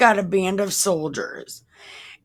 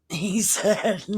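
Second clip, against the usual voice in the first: real giggle mid-phrase; laughing through words; 2 semitones higher than usual. Real, not acted. Reader resuming the verse about Jesus's answer, laughing. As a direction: try to read on through a laugh.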